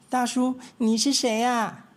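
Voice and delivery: high, lively little mouse voices; little mice. Acted. Three little mice chattering to each other, high and eager.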